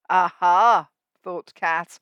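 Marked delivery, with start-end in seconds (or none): 0.1-0.9 sly aha. Acted. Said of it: "with a sly little smirk".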